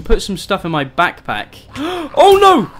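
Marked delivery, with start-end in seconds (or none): 1.8-2.7 gasp, then loud high cry; alarmed cry. Real, not acted. Player caught by a zombie, alarmed cry.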